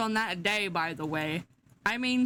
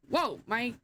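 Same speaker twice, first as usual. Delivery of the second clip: ordinary talk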